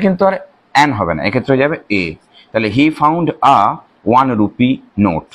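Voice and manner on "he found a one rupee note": one rupee note drawn out very slowly; very slow model sentence. Shown as a demonstration. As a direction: dictate it word by word. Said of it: "ওটাও কিন্তু vowel".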